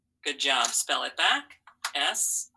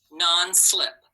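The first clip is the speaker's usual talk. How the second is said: stretched slowly; 2 semitones higher, 4 dB louder than usual. Shown as a demonstration. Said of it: "The next word I want you to try".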